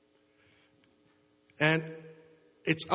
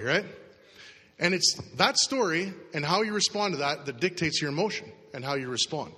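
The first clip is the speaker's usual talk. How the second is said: normal talking voice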